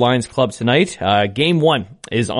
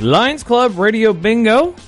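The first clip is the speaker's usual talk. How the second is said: bingo caller; 10 semitones higher, 3 dB louder, slower than usual. Real, not acted. Show intro, hyped title call.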